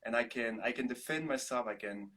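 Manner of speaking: normal talking voice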